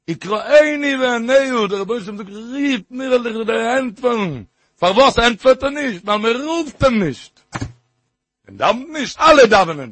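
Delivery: high, loud, ringing challenge; impassioned preaching. Real, not acted. Preacher challenging listeners why prayers go unanswered, impassioned preaching. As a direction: call out the verse, then hammer the question.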